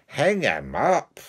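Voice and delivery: Duke's curt sentence, slow. Acted. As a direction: pronounce it like a haughty duke. The Duke's verdict.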